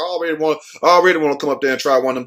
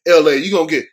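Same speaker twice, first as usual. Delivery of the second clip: ordinary talk, nothing marked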